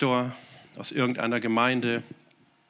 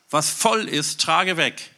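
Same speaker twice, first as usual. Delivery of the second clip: ordinary talk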